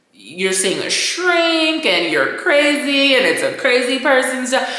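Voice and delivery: usual speaking voice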